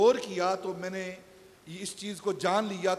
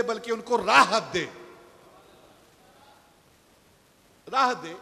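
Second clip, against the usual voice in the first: higher and louder, swelling sermon voice; fervent preaching; 5 semitones higher, 11 dB louder than usual. Acted. Preacher voicing the tree's counsel to listeners, fervent and loud. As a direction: preach it out with growing fire.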